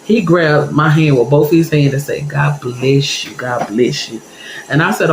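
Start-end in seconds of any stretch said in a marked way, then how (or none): 2.3-4.2 low, slow, soft husband blessing; creepy old man. Retold or imitated. The husband holds her hand with a low, slow blessing. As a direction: linger on it like a creepy deacon.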